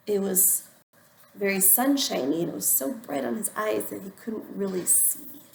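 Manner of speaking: ordinary delivery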